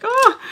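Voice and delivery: high, loud exasperated "oh"; exasperated groan. Retold or imitated. To herself, fed up and about to pop off, exasperated. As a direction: let out a pent-up cry of frustration. Boiling over inside, hiding it.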